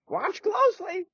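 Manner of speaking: put-on very high, creaky old-man squeak; a doddery old knight, indignant; old knight insists he is still strong. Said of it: "said Sir Smith".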